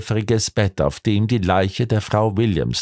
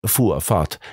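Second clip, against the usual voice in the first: ordinary delivery, nothing marked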